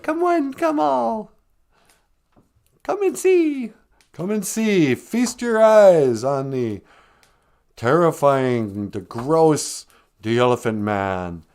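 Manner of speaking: high, loud barker, slowing on terrifying; carnival barker. Retold or imitated. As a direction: draw the crowd in like a showman. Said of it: "those carnival barkers".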